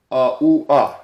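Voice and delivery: three separate vowel sounds, "Uh, ooh, uh"; triphthong demo. Shown as a demonstration. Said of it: "look what it says right here".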